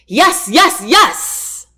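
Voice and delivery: high, loud, jubilant repeated cry; overjoyed shouting. A narrator's reading. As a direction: whoop it out while spinning her. Spinning her after the proposal.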